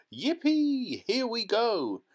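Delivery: high, loud whoop of joy; excited shout. A narrator's reading. Old grandfather whooping to Charlie on the lift, high and loud. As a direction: whoop it like a thrilled old man. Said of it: "Grandpa Joe shouted".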